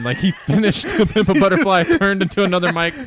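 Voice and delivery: high, loud, laughing through words; cracking up mid-sentence. Real, not acted. Laughing retelling joke.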